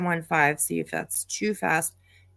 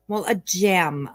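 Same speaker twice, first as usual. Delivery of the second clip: ordinary talk, nothing marked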